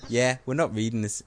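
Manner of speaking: normal talking voice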